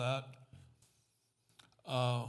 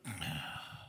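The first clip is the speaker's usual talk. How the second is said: long low sigh; a tired sigh that closes the joke; heavy sigh; 9 semitones lower, 9 dB quieter than usual. Real, not acted. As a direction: let out a weary breath. Weary sigh after the joke.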